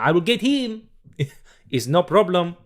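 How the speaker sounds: high, loud French hero voice; hammed-up French accent, calling out to the rescue; Bon Voyage. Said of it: "have a Bon Voyage impersonation".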